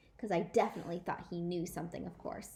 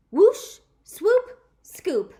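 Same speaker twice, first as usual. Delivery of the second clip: sound effects; 10 semitones higher, 14 dB louder, much slower than usual. Acted.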